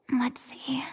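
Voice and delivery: hushed whisper over the diary. Acted. Detective whispering while reading the captive's diary. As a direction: breathe the words as you read.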